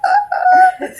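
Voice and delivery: very high loud shriek; children's scream. Acted. Children frightened by the creepy toy, very high shriek. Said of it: "little children going".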